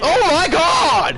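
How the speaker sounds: yelling with shocked delight; loud amazed shout with laughter; excited shouting. Real, not acted. Big play in football game.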